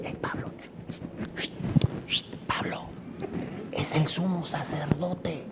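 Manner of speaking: hissed warning whisper. Acted. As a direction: tss to get his attention, whispered. Bystander warns Paul secretly.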